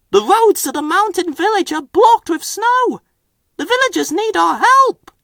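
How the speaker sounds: high, urgent little engine; Percy's voice. A narrator's reading. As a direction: report the news quickly and earnestly. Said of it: "whistled Percy".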